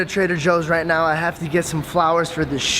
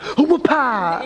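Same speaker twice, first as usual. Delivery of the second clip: high mock whip-crack cry; imitating sitcom whip sound; 6 semitones higher, much slower than usual. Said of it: "when he's like".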